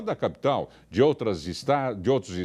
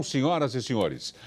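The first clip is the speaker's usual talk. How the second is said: ordinary delivery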